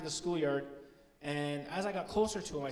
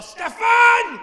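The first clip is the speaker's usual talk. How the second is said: very high, very loud scolding yell; officer mock-scolding an imaginary culprit, very high and loud; mock outraged shout; 18 dB louder than usual. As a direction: yell the name like a furious parent. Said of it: "I'm all".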